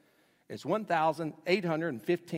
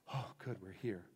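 relieved thought; 7 semitones lower, 11 dB quieter than usual. Retold or imitated. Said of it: "I thought".

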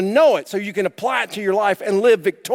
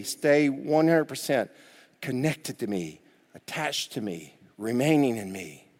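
soft, slow, lower tender plea; 6 semitones lower, 7 dB quieter than usual. Retold or imitated. Jesus pleading softly with his followers, slow and tender. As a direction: draw them close gently.

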